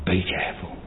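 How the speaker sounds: hushed, breathy whisper; whispered warning. Shown as a demonstration. Preacher repeats his warning to the flock, whispered. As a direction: drop to a whisper to warn them.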